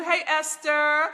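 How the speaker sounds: normal talking voice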